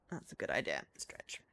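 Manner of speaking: breathy whispered aside to herself. Real, not acted. Playing alone, murmuring a sudden idea in a whisper. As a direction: hush it like a secret idea.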